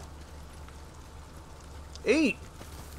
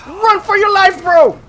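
high, loud shouting, then slower; panicked shout; 9 semitones higher, 10 dB louder, slower than usual. Real, not acted. Gamer shouting as he runs from the enemy. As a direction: yell to flee in panic.

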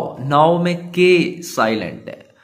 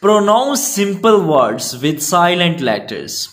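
unhurried textbook read; slow reading; 3 semitones higher, much slower than usual. Acted. Teacher reading the definition to pupils, slow. Reads instruction slowly.

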